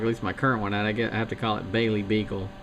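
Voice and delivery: normal talking voice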